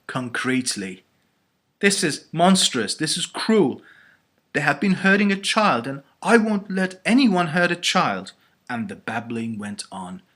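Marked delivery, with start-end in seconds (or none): 1.8-8.3 loud, high-pitched indignation; outraged protest. A narrator's reading. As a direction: protest fiercely to defend a child. Eleanor outraged at child being hurt.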